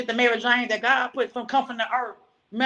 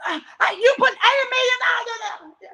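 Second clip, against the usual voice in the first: high, stammered shout of disbelief; retold outburst, much higher and louder; outraged husband; 11 semitones higher, 4 dB louder than usual. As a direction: imitate a husband exploding over money. Retelling husband's outraged reaction.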